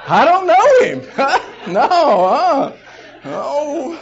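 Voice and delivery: high, loud and slow, laughing denial; disowning him. Retold or imitated. Embarrassed disciple disowns Jesus, loud slow laughing denial. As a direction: back away from him with a laugh.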